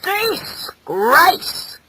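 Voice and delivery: high, outraged fatherly cry; drawn-out shout of contempt. Acted. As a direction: spit it out as a condemnation. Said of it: "he shouted".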